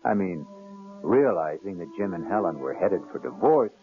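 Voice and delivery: normal talking voice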